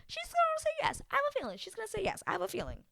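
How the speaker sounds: high, rushed hopeful insisting; squeaky-high wishful thinking, quick; hopeful prediction. Retold or imitated. Retelling her own hopeful prediction.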